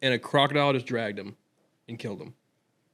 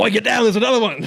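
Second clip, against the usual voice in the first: loud, high Australian yelling a warning; Australian accent; 9 semitones higher, 8 dB louder than usual. Retold or imitated. Mimicking an Australian bloke shouting a warning, loud Australian accent. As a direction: shout it like an Aussie spotting danger, pitched up high. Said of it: "Yeah, that's good".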